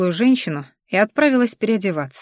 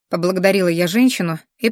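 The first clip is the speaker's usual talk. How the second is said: ordinary delivery, nothing marked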